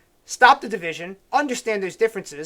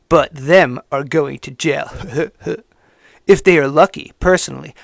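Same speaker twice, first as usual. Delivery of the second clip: mock hater's voice; 5 semitones lower, 6 dB louder than usual. Host reading a hater's post aloud, lower and louder in mocking voice. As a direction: sneer it out as the smug writer. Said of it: "read it in the voice I assume he wrote it in".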